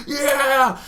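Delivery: high, drawn-out loud yeah; triumphant scream. Retold or imitated. Celebrating the hit on the wasp, triumphant scream. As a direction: yell it like a victory cry. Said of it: "I'm screaming".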